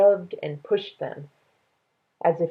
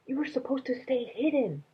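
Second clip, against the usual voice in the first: a lightly voiced girl, higher and softer, quick; Minley's voice; 7 semitones higher, 5 dB quieter than usual. A narrator's reading. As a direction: ask like a curious, worried girl. Minley scolds Dragon.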